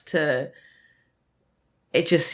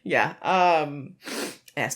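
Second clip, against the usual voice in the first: ordinary talk, nothing marked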